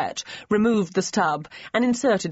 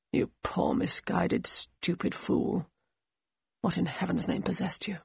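talking to himself; 7 dB quieter than usual. A narrator's reading. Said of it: "remarked to himself".

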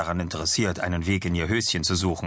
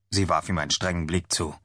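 usual speaking voice

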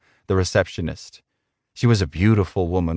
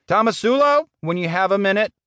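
high-pitched boss calling out; boss summoning employee; 12 semitones higher than usual. Acted. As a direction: call the underling in, voice pitched well up.

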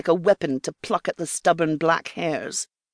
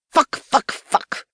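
loud curse fading to a whispered one; swearing; 7 semitones higher, 3 dB louder, slower than usual. Acted. Sophie swearing silently as she plucks, fading. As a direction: punch each curse then let it breathe out.

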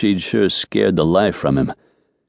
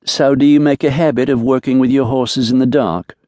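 plain reading voice

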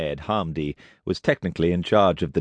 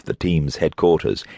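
usual speaking voice

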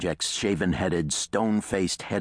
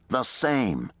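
raised, emphatic reply; 6 semitones higher than usual. A narrator's reading. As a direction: answer with firm emphasis. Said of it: "Reese replied emphatically".

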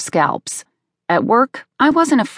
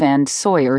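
ordinary delivery, nothing marked

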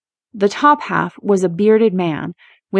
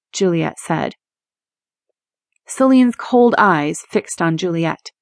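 usual speaking voice

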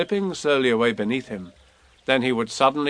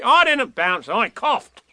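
higher, louder cross denial; cross Tigger; 10 semitones higher, 4 dB louder, slower than usual. A narrator's reading. Tigger interrupting Eeyore, cross higher denial. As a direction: snap back indignantly. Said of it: "said Tigger crossly".

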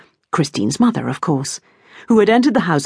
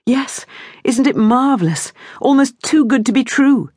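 excited; 8 semitones higher than usual. A narrator's reading. Dinah excited about brother.

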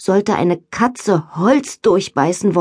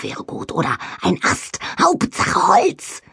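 greedy whisper; 3 dB quieter than usual. A narrator's reading. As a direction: whisper it with craving. Beaver craving wood.

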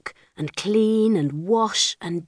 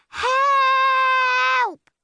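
high, long desperate call; shouted for help. A narrator's reading. Lost girl shouts for help in the woods. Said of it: "she shouted".

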